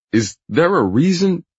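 high, loud flustered question; tentative protest. A narrator's reading. Roach questioning the boss's order, high blustering protest. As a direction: try to object, pitch climbing. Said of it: "Roach tries again".

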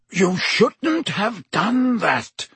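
high, very slow reproach. A narrator's reading. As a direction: hold him back gravely.

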